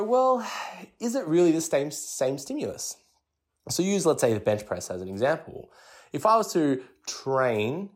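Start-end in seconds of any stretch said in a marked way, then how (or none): 0.0-3.0 doubtful head-scratch. Retold or imitated. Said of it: "scratch our head and go".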